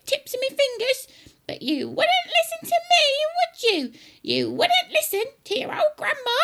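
very high, loud granny shriek; high-pitched, boastful grandma rant; gloating grandma. Acted. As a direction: crow triumphantly as a cranky old woman. Acting Grandma.

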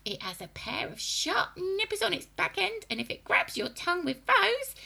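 put-on high, loud old hag voice; gleeful witch warning the boy; witchy grandma voice. A narrator's reading. Grandma warning about earwigs.

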